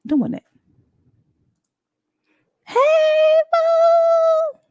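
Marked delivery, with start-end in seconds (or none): 2.6-4.6 excited greeting. Real, not acted. Greeting a viewer joining late.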